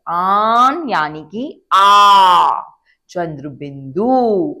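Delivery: आन drawn out slowly with a nasal ending; nasal sound demo. Shown as a demonstration. Teacher showing आन as आ with chandrabindu. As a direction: stretch आन very slowly through the nose. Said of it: "की आवाज आ रही है".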